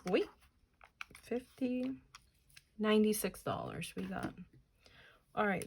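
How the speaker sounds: ordinary talk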